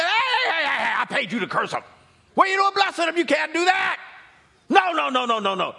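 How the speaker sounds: king yelling high and loud at his prophet; furious king. Acted. King yelling at Balaam for blessing instead of cursing, furious shout. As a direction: scream it at the hired prophet, outraged. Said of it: "Balak was so mad".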